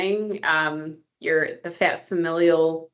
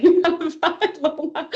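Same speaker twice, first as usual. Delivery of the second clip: high giggly laugh through words; 9 semitones higher, 4 dB louder than usual. Real, not acted. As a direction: laugh it out, teasing. Laughing while teasing the speaker.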